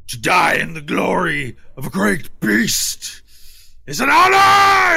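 slow, rising, loud battle shout; fanatic warrior cry. Acted. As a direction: charge to a glorious death. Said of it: "and he goes".

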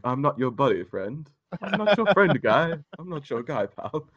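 lightly voiced cartoon Canadian, climbing high; South Park Canadian bit. Quoting South Park Canadians to his cohost, lightly cartoonish. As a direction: bounce the lines back and forth, rising. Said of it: "Or the whole, like".

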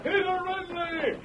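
high, slow, drawn-out hail; calling out. Acted. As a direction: call the name from far off. Rider hails wagon from afar.

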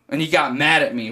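ordinary talk

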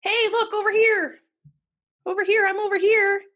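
high, loud taunting call; taunting killer. Retold or imitated. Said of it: "he was like".

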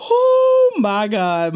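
loud, slow, drawn-out oh my God; real shock on reading the card; stunned exclaim. Real, not acted. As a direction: gasp it out in disbelief.

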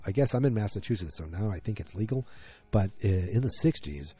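ordinary talk, nothing marked

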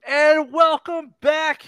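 big shouted show opener; welcome shout. Acted. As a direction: belt out the welcome.

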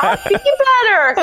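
imitating her own sobbing reply; hammed-up crying voice; mock bawling. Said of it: "and I'm bawling".

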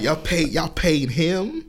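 drawn-out sassy disbelief, put on; sassy imitation. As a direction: imitate a sassy player questioning a deal. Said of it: "being really sassy online".

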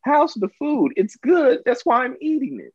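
high-pitched retort, starting slow; mock indignant. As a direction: play the offended eater, voice pushed up high. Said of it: "You're like".

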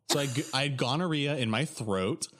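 ordinary talk, nothing marked

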